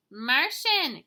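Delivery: loud, high storybook answer from afar; lightly hollered password reply; calling back. Acted. Unseen voice calls back answer.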